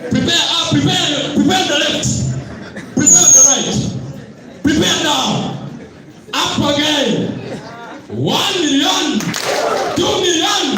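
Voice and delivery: high, excited MC chant to the crowd; hype call. Acted. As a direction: whip the audience up, laughing. MC hyping crowd for guest.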